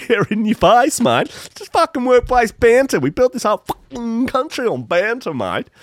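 high, hammed-up ocker bloke voice; mock Aussie bloke. Podcaster mocking the farting boss, hammed-up Aussie bloke. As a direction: lampoon the boss's banter excuse.

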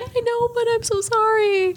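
high, whiny apology; tearful apology. Retold or imitated. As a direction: beg forgiveness in a high voice. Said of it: "And she's like".

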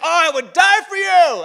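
high, loud hammed-up vow; over-the-top devotion. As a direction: proclaim it like a super all-in friend. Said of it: "super all-in crazy friends".

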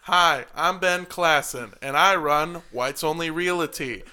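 loud, cheesy realtor commercial; TV ad pitchman. Pretend. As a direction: sell it like a local TV ad. Mock realtor advert, loud.

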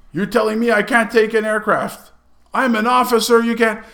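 high, loud pulling of rank; mock indignation. Lieutenant is refused an aircraft by the sergeant, loudly indignant. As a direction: play an affronted, entitled officer.

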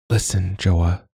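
slow, sincere and serious; earnest. A narrator's reading. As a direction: make him really listen. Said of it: "Mila said earnestly".

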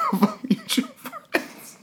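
high, helpless laughter through words; laughing hard. Real, not acted. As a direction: laugh through every word. Telling embarrassing story.

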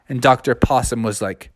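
plain reading voice

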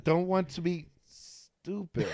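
slow line with a long held S in "stupid"; stall, then ease carefully into the S of "stupid". Shown as a demonstration. Man showing how he dodged the lisp, drawn-out careful "stupid". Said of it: "would slow down for that word".

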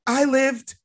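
mock correcting. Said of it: "She's like".

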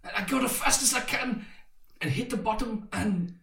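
high-pitched, excited downhill rush; excited re-enactment. Retold or imitated. Rider reliving a bike ride down the hill, excited.

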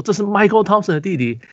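high, amused echo of the intro; mock Michael Jordan. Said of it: "Michael Jordan说".

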